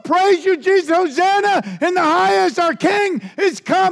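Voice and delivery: loud, high ecstatic crowd shout; crowd cheering. Retold or imitated. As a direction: cheer like a jubilant crowd hailing a king. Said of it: "these people who are just".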